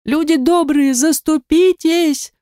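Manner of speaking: high, drawn-out cry for help; a beaten man shrieks to the people on the hills, high; screaming plea. A narrator's reading. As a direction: beg the crowd to step in. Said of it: "хрипу и визгу больше".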